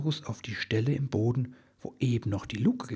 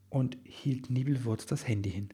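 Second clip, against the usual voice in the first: plain reading voice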